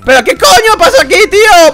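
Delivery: panicked cry at a bugging map; alarmed outcry. Real, not acted.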